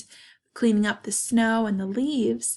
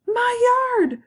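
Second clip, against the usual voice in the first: high, loud, acted outcry; dismayed shout; 14 semitones higher, 7 dB louder than usual. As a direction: shout it as an appalled dad. Dad exclaims at the wrecked yard.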